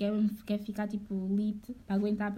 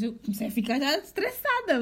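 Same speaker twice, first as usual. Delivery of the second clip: ordinary delivery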